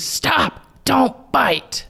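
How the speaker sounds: high, harsh stop command; sharp high parental scold. Shown as a demonstration. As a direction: snap it at the child like a frustrated parent.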